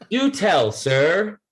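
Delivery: high, mock-polite; father mocking his stressed kid, sarcastic. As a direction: tease him with fake courtesy.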